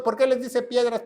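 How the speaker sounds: ordinary delivery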